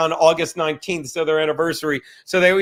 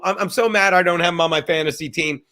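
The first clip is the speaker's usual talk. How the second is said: usual speaking voice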